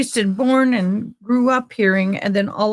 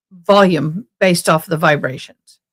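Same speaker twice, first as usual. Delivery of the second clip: ordinary talk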